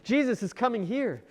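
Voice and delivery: a touch of breathless wonder; excited brother. Acted. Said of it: "he kept talking".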